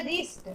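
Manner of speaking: soft "रिस्ट" starting straight on r; silent w. Shown as a demonstration. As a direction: say "रिस्ट" softly with no w. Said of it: "रिस्ट में भी डब्ल्यू का sound नहीं". ("रिस्ट" में डब्ल्यू मौन)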